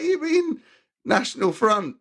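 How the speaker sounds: can't believe what he just heard; incredulous. Retold or imitated. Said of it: "I was like".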